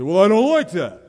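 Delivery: loud, high, laughing mock complaint; mock objection. Preacher voicing a grumbling listener, loud and laughing. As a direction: play the sulky objector with a laugh.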